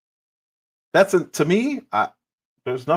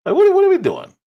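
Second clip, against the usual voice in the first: much higher and louder, baffled; incredulous squeal; 6 dB louder, faster than usual. Real, not acted. Commentator reacting to the panel fight, incredulous high squeal. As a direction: shriek the question in disbelief.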